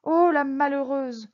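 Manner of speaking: loud, drawn-out cry of dismay; appalled exclamation; exclaiming. A narrator's reading. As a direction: cry out slowly and loudly. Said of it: "s'écria le commissaire".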